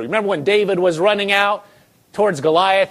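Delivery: ordinary delivery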